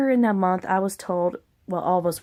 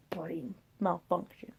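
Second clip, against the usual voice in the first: softer, slow deadpan; muttered aside; 2 semitones lower, 11 dB quieter, slower than usual. Acted. Mocking own slowness in a soft aside. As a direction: mutter it to yourself.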